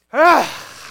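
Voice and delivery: a sudden loud, high ah as the words fail; loud exasperated ah. Shown as a demonstration. Preacher voicing Paul lost for words, loud ah. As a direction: let out a frustrated ah. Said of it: "what his kind of verbal gesture he gives".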